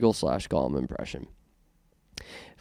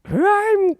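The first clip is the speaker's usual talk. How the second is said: shrieking, much higher and louder witch cackle-voice; Wicked Witch impression; 7 dB louder than usual. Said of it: "Wicked Witch of the West impression".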